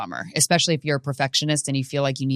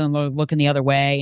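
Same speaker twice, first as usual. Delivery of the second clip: ordinary talk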